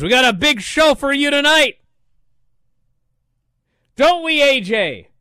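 loud, high mock announcer voice, the last bit slowed; Tony Schiavone. Host mocks the interviewer filling dead air, loud and high. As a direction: pump it up like a ring interviewer stalling. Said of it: "Tony goes".

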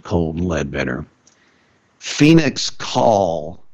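normal talking voice

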